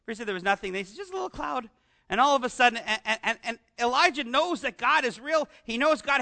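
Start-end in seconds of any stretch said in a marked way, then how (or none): 1.0-1.7 higher, softer, offhand little voice; servant's report. Retold or imitated. Servant telling Elijah about the cloud, light offhand voice. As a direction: shrug it off like a servant.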